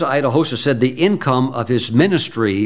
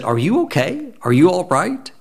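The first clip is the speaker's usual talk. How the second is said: concerned; 6 semitones higher than usual. Retold or imitated. T L worried at night.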